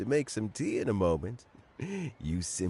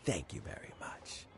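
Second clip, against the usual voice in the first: weak whisper; 3 semitones lower, 8 dB quieter than usual. Acted. Weak recovering old man thanks.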